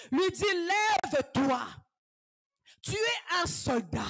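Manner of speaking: ordinary delivery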